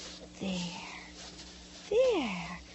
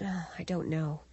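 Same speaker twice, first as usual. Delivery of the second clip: hushed unvoiced whisper; whispered worry; 8 semitones lower, 11 dB quieter than usual. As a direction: keep it secret from the boy. Hushed talk about the child.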